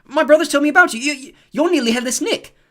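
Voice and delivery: put-on very high, loud eager boy; excited Ron. A narrator's reading. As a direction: blurt it out eagerly. Ron blurts to ghost.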